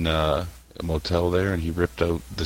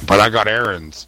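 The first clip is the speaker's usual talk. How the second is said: higher, louder mock excuse; Zajo; 3 semitones higher, 6 dB louder than usual. Mimicking a friend begging off, raised mocking impression. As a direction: protest it in a raised put-on voice. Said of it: "that was my Zajo impression".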